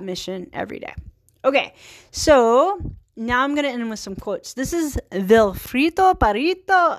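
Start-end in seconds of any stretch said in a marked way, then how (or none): 4.5-7.0 slow, hammed-up Italian accent; overdone fake Italian accent; horrible Italian accent. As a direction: draw the name out in a bad mock-Italian lilt. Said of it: "doing a horrible Italian, uh, accent".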